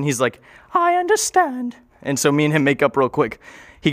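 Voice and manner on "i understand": much higher, slow voice of his own brain; a much higher brain voice complaining it was locked away; brain's voice. Retold or imitated. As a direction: play the hurt inner voice, pitched far up and dragged out. Brain answers.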